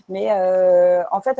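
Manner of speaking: ordinary delivery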